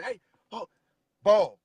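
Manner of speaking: sudden high call, then a loud bark of ball; yelling a warning mid-play. Retold or imitated. Calling out to dog handler.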